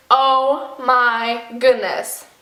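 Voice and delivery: slow, spaced-out realization; heavy, deliberate dismay; dawning dread. Retold or imitated. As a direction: draw each word out as it sinks in.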